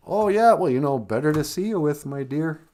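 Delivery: a wolf badly pretending to be granny; wolf faking grandma. The wolf answering Red, putting on a high grandma voice.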